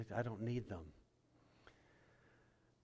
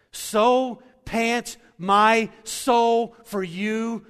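loud, very slow, soaring psalm; a pastor proclaiming David's psalm, slow and loud; preached psalm quote; 9 semitones higher, 17 dB louder, much slower than usual. Acted. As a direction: stretch every word of the psalm, much louder. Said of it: "this is how David described it".

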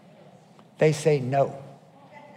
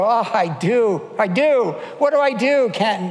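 higher-pitched, mock-tearful pleading; imitated tearful plea; 4 semitones higher than usual. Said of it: "who in tears go".

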